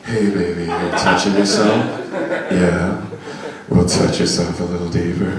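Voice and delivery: very low, hammed-up sleazy voice; exaggerated deep seducer. Coach mocks how not to say it, exaggerated deep seduction. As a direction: push it absurdly deep. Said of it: "So I'm not like".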